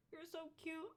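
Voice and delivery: high, soft coo to a beloved dog; retold gushing in a small high voice; tearful cooing. Cooing to dog while crying.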